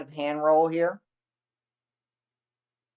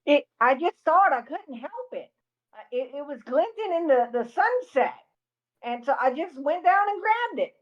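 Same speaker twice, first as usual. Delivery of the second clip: pitched up, sheepish excuses; high sheepish voice; 8 semitones higher than usual. Acted. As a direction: squeak out a guilty excuse. Player's character makes excuses.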